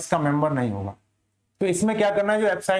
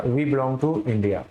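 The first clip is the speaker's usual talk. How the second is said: spelled out slowly for the class; slow example sentence; 5 semitones lower, much slower than usual. Shown as a demonstration. Teacher modelling how to answer abroad, slowly. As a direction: read it out slowly so students catch it. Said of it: "या".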